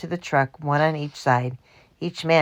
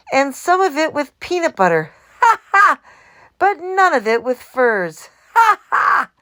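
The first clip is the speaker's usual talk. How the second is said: laughing; 10 semitones higher, 7 dB louder than usual. A narrator's reading. Mac laughing roll call.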